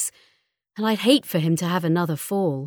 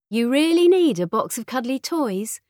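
raised-pitch disbelieving question; incredulous mother; 7 semitones higher than usual. A narrator's reading. Mother querying her daughter's box of toys, incredulous. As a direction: question it as if it's absurd, pitched up.

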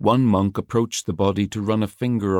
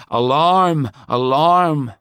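loud, higher yell like a clanging bell; yelled alarm; 6 semitones higher, 6 dB louder, slower than usual. A narrator's reading. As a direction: ring out the warning at full voice. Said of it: "The biggest bells yelled".

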